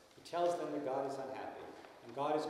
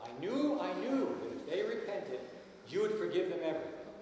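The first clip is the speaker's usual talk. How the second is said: higher, louder, exasperated outburst; exasperated complaint; 6 semitones higher, 5 dB louder than usual. Retold or imitated. Jonah complaining bitterly to God, exasperated.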